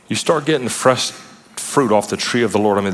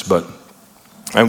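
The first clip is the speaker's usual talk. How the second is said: normal talking voice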